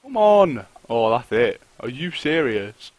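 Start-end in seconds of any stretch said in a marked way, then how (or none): 0.0-0.7 loud, high burst of frustration; loud high frustrated yell; frustrated shout. Real, not acted. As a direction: shout it at the screen. Frustrated at the game.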